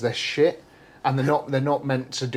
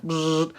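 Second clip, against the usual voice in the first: imitated welding buzz; 5 semitones higher than usual. Imitating welder noise.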